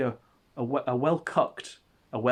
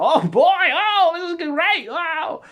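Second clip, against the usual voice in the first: very high, loud whooping exclamations; loud high-pitched cartoonish hollering; Gator impression; 11 dB louder, slower than usual. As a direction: whoop it out, shrill and over the top. Acts out Gator shouting into laptop.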